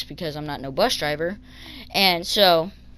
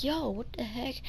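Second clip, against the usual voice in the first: very soft, high retold protest; muted disbelief; 6 semitones higher, 11 dB quieter than usual. Kid reacting to driver's threat, muted retold disbelief. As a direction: object under your breath, higher.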